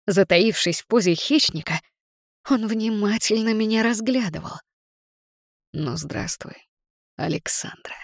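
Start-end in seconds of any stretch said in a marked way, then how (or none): 5.7-7.9 low, soft velvety whisper. Acted. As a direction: draw her name out low and smooth. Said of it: "Его бархатистый голос".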